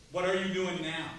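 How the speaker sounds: ordinary delivery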